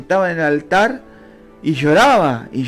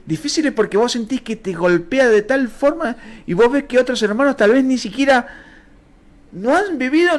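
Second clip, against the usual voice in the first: preaching his testimony higher and pushing harder; impassioned preaching; 7 semitones higher than usual. Acted. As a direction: pour out the hard years to the congregation. Preaches hardship testimony, raised.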